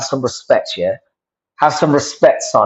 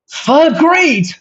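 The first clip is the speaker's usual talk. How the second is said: high-pitched; 13 semitones higher than usual. Real, not acted. Startled by a chat comment, voice going very high-pitched. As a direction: voice shooting up in disbelief. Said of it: "my voice went so high pitched there".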